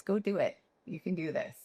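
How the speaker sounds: soft, reassuring boss voice, a touch of a smile; calm encouragement. Retold or imitated. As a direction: ease her nerves gently. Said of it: "with that smile of his".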